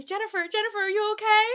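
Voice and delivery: put-on worried boyfriend. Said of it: "come running up to Jennifer and say".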